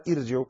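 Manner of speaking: "ارجعوا" short, with a thin, light ra; wrong light ra. Shown as a demonstration. Teacher showing the misreading, "ارجعوا" with light ra. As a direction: say "ارجعوا" with the ر thinned. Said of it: "لا تقرأها".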